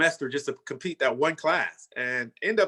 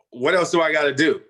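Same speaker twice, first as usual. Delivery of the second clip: louder, fed-up demand; exasperated outburst; 2 semitones higher, 8 dB louder than usual. Retold or imitated. Frustrated after being called too small, a loud exasperated outburst. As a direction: throw the question out in frustration.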